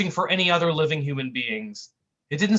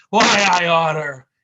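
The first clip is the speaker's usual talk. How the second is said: slow, loud gangster growl; 2 semitones higher, 10 dB louder, slower than usual. Acted. Tough guy threatening his clumsy partner, loud growl. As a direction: threaten it through the teeth. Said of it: "he growled".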